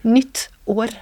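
"Nytt år" repeated slowly, "nytt" stressed, falling; Norwegian pronunciation. Shown as a demonstration. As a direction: model "Nytt år" clearly, stressing "nytt". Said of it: "even more unpronounceable".

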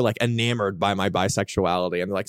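usual speaking voice

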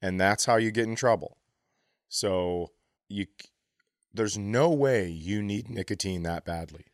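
normal talking voice

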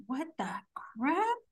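hushed, disbelieving whisper; stunned whisper. Retold or imitated. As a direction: whisper it, breaking before the last word. Three women react in disbelief.

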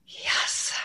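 a breathless whispered "Yes," of private triumph. Retold or imitated. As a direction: whisper it like a secret victory. Imagined mom's quiet triumph.

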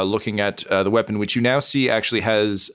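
ordinary talk, nothing marked

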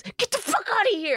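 high-pitched mock angry outburst; imitating a snapping brush-off; angry shout.

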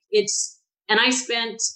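ordinary delivery

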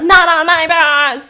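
loud, drawn-out mock yell; angry yell. Bus driver yelling at the vomiting passenger, mock angry yell. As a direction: imitate a driver bellowing, stretching it out.

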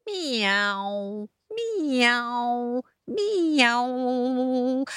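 drawn-out meow gliding down from high; long imitated cat meows. A narrator's reading. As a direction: meow like a cat playing its part. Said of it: "Cat can be the lead guitarist".

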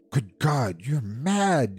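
appalled exclamation, held back in volume; aghast. A narrator's reading. Husband to his wife about her wish, aghast. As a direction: recoil at the idea, aghast. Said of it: "he cried aghast".

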